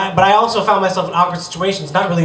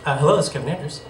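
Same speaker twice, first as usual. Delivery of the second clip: subdued, softer formal phone greeting; dad's phone voice; 2 semitones lower, 6 dB quieter, faster than usual. Retold or imitated. Comic telling of dad answering the phone, restrained formal voice. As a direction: imitate dad politely answering an unknown caller. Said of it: "he answered the phone like this".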